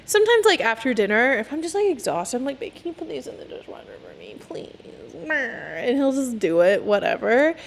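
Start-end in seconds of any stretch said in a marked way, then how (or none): 2.6-4.8 soft pleading request. Retold or imitated. Asking her boyfriend to load the dishwasher, softly pleading. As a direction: ask sweetly, almost breathing it.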